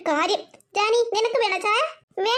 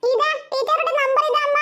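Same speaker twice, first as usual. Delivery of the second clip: put-on higher child's voice, upset; sulky little girl; 4 semitones higher than usual. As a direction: play a small girl refusing school. Child Devu hands number. (പിണങ്ങിയ കൊച്ചുകുട്ടിയുടെ ശബ്ദം)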